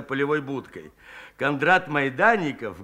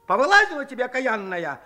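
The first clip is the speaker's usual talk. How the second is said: a higher, snapping scold; old man lashing out; 6 semitones higher, faster than usual. A narrator's reading. Old Shchukar rounds on the woman, scolding sharply. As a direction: bite back at the woman. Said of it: "обрушился на бабу дед Щукарь".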